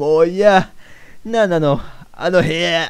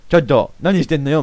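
normal talking voice

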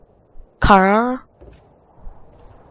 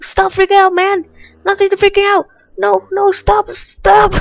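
high-pitched, raised-voice scolding; yelling at zombie; 12 semitones higher, 5 dB louder than usual. Real, not acted. Player telling a freaking-out zombie to stop, high yelling. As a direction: tell it off in a high, excited yell.

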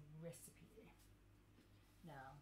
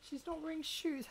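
mock outrage; 9 semitones higher, 6 dB louder than usual.

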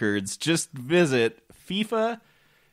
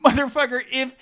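high exasperated rant; 8 semitones higher than usual. Real, not acted. Rant climbing higher.

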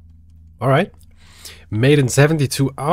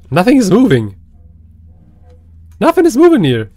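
high, loud, nervous insisting; loud, high edgy protest; 6 semitones higher, 6 dB louder than usual. Real, not acted. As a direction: insist it anxiously. Nervous while hearing noises.

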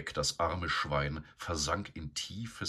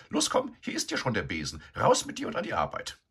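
high, loud bossy lady pig; bossy sow; 6 semitones higher, 5 dB louder, faster than usual. A narrator's reading. Begonia ordering Eduard to clean the shed, bossy. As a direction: order him about, haughty.

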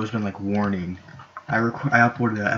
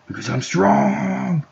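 very slow, high, stretched-out boast; mock heroic cry; 10 semitones higher, 3 dB louder, much slower than usual. Player boasting while stealing the leader, mock heroic cry. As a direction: stretch the last word into a high held cry.

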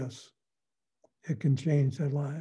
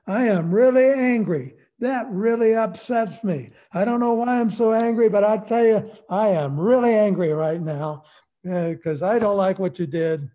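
loud, higher, openly angry venting; angry I-statement; 4 semitones higher, 7 dB louder than usual. Shown as a demonstration. Parent showing a child how to voice anger, loud and angry. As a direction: say your anger out loud, firmly. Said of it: "talk about yourself and your anger like this".

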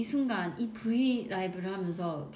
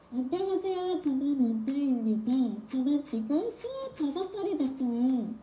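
suddenly muffled censor; 5 semitones higher than usual. Shown as a demonstration. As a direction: speak through a covered mouth. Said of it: "입 막고 말하기".

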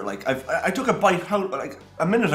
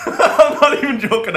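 high, loud laugh through words; 10 semitones higher, 10 dB louder, slower than usual. Real, not acted. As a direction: laugh it out helplessly. Overcome while tasting donut.

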